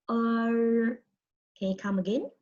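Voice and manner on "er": long level 'er' with the tongue rolled; retroflex er. Shown as a demonstration. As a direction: roll your tongue on 'er' and hold it. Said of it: "we pronounce this as".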